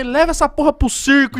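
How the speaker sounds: high, loud annoyed outburst; mock angry mom. Annoyed mother telling the other mom off, high and loud. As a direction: snap back at the bragging mom. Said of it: "A minha mãe".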